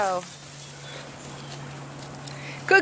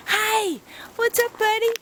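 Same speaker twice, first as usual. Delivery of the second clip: ordinary talk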